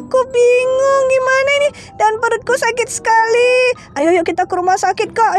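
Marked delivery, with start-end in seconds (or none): 0.0-3.8 high, startled sister in pain; a frightened girl whose belly suddenly hurts; panicked older sister. Acted. Sister figure again. (kakak perempuan panik bersuara tinggi)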